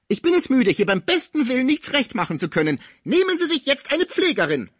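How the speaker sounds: spiteful shrill outburst. A narrator's reading. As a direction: spit the words like pistol shots. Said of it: "mit den gehässigen ... Blicken an".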